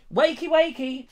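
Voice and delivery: high, sing-song nudge; wake-up call. Pretend. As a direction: coax a sleeper awake in a high voice. Trying to wake sleeping Julia.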